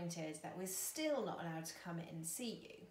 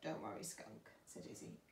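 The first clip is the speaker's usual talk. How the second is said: low, soft comfort; reassuring; 4 semitones lower, 7 dB quieter than usual. Acted. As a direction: soothe a frightened friend softly. Izzy reassures Skunk.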